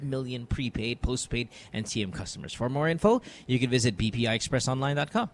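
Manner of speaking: live radio sponsor read. Acted. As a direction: read the promo smoothly. Reads a sponsor ad.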